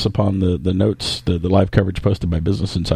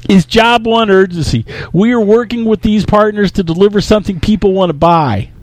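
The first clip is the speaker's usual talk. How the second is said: high, loud blustering CEO; Steve Ballmer; 10 semitones higher, 4 dB louder than usual. Acted. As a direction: proclaim it like an over-excited executive. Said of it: "absolutely fantastic Steve Ballmer impression".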